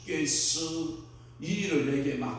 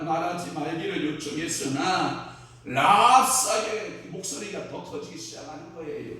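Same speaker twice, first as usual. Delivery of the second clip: ordinary talk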